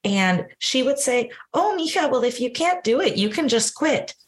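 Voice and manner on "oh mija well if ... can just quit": lightly voiced mom, much higher on Oh, Mija; a hint of a calm mother offering an easy out; imitating mom.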